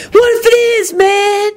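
very high, defiant retort; defiant snarl. A narrator's reading. Drunk singer to his host, snarling defiantly. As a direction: snap back defiantly, pitch way up. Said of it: "Carmine snarled defiantly".

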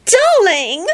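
high, gushing greeting; thrilled. A narrator's reading. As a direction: squeal with delight at your lover's call. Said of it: "Solange sounded so thrilled".

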